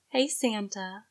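soft, seductive greeting; low sultry voice. Acted. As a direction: breathe it out softly, inviting. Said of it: "in a low, sultry voice".

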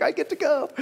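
put-on high, eager kid voice; a fifteen-year-old who can't wait to go; excited teen. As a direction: younger brother thrilled to go to the front lines, pitched high. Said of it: "He's like".

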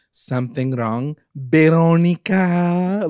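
slow needling question, name stretched out high and loud; Hermosa, pointed intent. Retold or imitated. Sister needling Veronica at the diner, drawn-out pointed imitation. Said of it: "she says it with such intent".

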